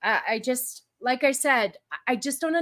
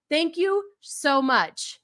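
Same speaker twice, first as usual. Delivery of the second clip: gushing thanks; 2 semitones higher than usual. Real, not acted.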